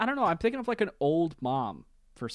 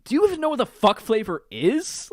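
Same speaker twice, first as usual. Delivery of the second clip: mocking echo; 9 semitones higher, 6 dB louder than usual. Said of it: "mocking the question back".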